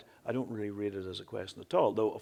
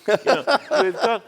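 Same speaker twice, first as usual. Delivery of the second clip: much higher and louder, laughing through the words; laughing excitedly; 11 semitones higher, 15 dB louder than usual. Real, not acted. Poet on the panel laughing as he jokes to the audience. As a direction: burst into a gleeful laugh.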